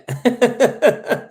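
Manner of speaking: a loud high-pitched laugh. Real, not acted. Amused by a viewer praising his advice, loud high laugh.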